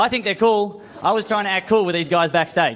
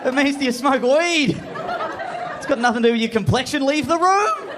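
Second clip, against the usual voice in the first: inner scolding; 11 semitones higher than usual. Retold or imitated. His own brain berating him for the sunscreen answer, mock angry. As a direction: yell at yourself in your head. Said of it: "my brain's gone".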